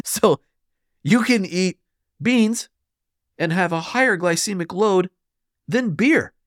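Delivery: building outrage at the beer numbers; heated up. Real, not acted. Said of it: "You can tell I'm getting heated up".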